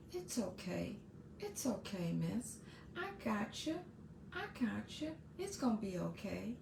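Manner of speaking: put-on soft, gentle voice of a calming girl; soothing reassurance. Girl softly reassuring her stressed teacher. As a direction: hush her worries softly. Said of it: "what she said to me?".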